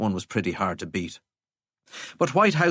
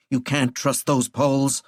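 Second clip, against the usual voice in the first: ordinary delivery, nothing marked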